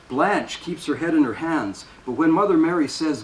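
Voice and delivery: ordinary delivery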